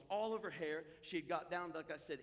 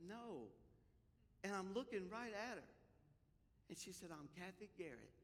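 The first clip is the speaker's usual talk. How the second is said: hushed telling; 3 semitones lower, 9 dB quieter than usual. Retold or imitated. Hushed retelling of the reveal.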